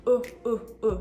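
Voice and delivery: e repeated alone as a schwa; isolated schwa sound. Shown as a demonstration. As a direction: hold the bare e sound. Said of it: "The first syllable is de".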